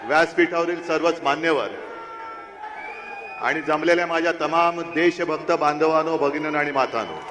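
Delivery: rousing political speech to a crowd; rally speech. Real, not acted. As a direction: address the crowd from the stage.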